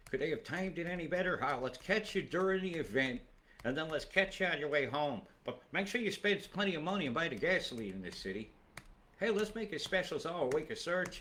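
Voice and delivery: sarcastic scheming. Acted. Voicing planners timing an event for Melbourne, sarcastic scheming. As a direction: pitch it up like a gleefully sarcastic plan.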